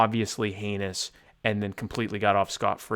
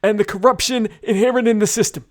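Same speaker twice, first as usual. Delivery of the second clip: high, loud mock campaign speech; political speech voice; 11 semitones higher, 6 dB louder than usual. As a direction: declaim like a candidate. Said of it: "now I'm being given things to say".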